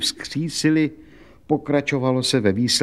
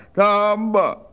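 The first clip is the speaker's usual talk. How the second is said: put-on much higher, keening complaint of an old washerwoman; wailing lament; 13 semitones higher than usual. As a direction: wail out her hard life, high and plaintive. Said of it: "dala se do řvaní".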